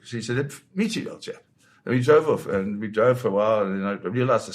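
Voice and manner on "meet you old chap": high-pitched mock English gent; comic English old-chap accent. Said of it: "sort of English accent".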